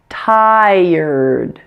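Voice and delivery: very drawn-out "tired", er melting into d; smoothly blended ending. Shown as a demonstration. Teacher puts the ending back into the word, "tired" blended smoothly. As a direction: stretch "tired" slowly so both ending sounds are heard. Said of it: "Blend them together smoothly".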